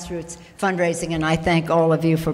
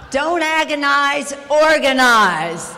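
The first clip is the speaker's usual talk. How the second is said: slower, louder and higher mock rally cry; hammed-up imitation of a fiery organiser, stretching each word; John Burton; 5 semitones higher, 6 dB louder, slower than usual. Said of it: "I won't say it exactly the way he says it".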